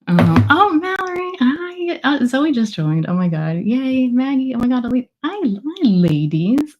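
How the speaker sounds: high, bright squeals of hello to each friend; excited greeting. Real, not acted. Author greeting friends joining her book launch live, thrilled. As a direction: greet them like long-lost friends, pitch way up.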